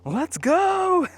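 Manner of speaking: hammed-up puppy yelling to leave; excited dog voice. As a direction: voice the dog straining to go. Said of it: "and now he's like".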